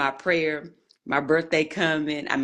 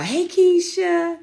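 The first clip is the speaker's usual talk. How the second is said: much higher, louder, drawn-out hey; excited call-out; 8 dB louder, much slower than usual. Real, not acted. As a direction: sing out the name. Host calls out to viewer.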